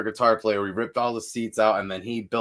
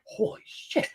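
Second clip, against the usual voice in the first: breathless whisper, higher; whispered shock; 11 semitones higher, 5 dB quieter than usual. Retold or imitated. Singer watching a woman hit her head, whispering in shock. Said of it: "I'm like".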